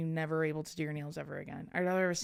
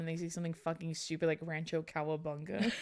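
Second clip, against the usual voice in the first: ordinary talk, nothing marked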